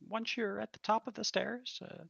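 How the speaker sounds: polite tavern waitress giving directions. Acted. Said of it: "She says".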